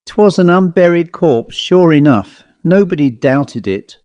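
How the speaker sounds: usual speaking voice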